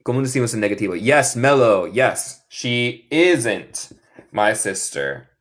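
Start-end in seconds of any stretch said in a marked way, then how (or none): 2.5-5.3 slow, careful "She isn't my sister"; drawn out for learners to repeat; slow model sentence. Shown as a demonstration. Teacher modelling for the class, slow and careful. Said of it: "teaching sentence slowly".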